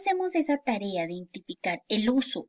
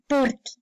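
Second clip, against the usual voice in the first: PORQUE in a single piece; "porque" as one unbroken word. Shown as a demonstration. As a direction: say "porque" joined, no gap. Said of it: "una sola palabra".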